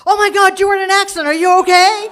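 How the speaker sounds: loud, high-pitched imitation of a freak-out; panicked shout. Partner hearing about the crash, panicked high shout.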